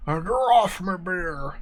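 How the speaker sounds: slow, slurring gnome voice; drunken gnome. Acted. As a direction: drawl it like a drunk little gnome. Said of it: "The drunken gnome says".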